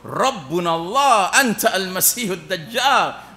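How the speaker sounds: defiant believers. Acted. Believers defy Dajjal.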